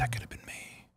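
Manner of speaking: hushed whisper. Retold or imitated. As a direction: breathe it in a whisper. Child self dreaming of diplomacy.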